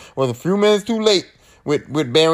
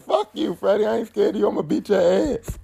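mock tough dare; 5 semitones higher, 3 dB quieter than usual.

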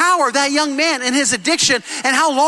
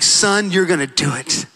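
lower, encouraging father; a hint of a tender dad; lightly voiced proud father; 6 semitones lower than usual. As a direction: reassure a broken son, gently. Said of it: "He's like".

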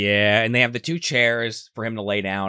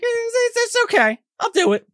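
very high, squeaky put-on voice; Karma shrugging off the warning in a squeaky falsetto; mock squeaky voice; 4 dB louder than usual. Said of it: "He's like".

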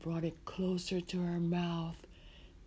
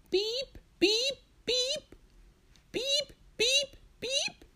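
very high, piercing electronic beeps; imitated beeping; slower than usual. Storyteller voicing the noise Marcia hears, high beeping. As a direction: mimic an alarm in groups of three. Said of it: "Marcia hear this noise".

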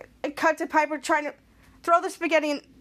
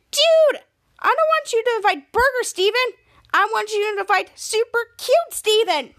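high, loud frustrated scolding; exasperated yelling; 6 semitones higher, 4 dB louder than usual. Retold or imitated. Piper scolding Jasper for inviting Burger Steven, loud and high.

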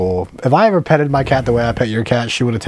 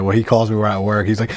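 usual speaking voice

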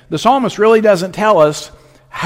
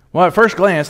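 ordinary talk

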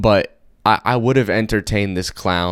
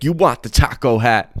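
pushy clown; 5 semitones higher, 5 dB louder than usual. Retold or imitated. Clown pushes hat on brother.

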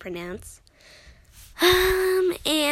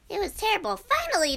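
dramatic; 9 semitones higher, 3 dB louder, faster than usual. Acted. Dramatic story exclamation.

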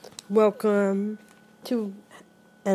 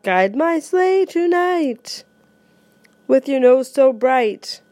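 louder, lively reading of a Christmas poem, often higher; poem recitation; 5 semitones higher, 8 dB louder than usual. Acted. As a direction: recite it with growing excitement for the reindeer.

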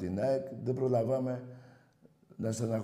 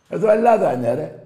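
much higher and louder, defiant; real, fierce patriotic yell; angry shout; 6 semitones higher, 11 dB louder than usual. Real, not acted. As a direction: bellow it like a final word. Host shouting at flag-bearers.

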